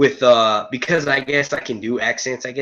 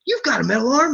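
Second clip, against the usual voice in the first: high, excited Spider-Man voice, well above his own; Spider-Man in amazement at the metal arm; 13 semitones higher than usual. Retold or imitated. Teen Spider-Man meeting Winter Soldier, high and amazed. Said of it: "He's like".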